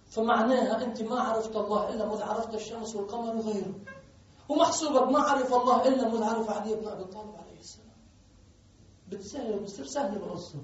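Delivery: normal talking voice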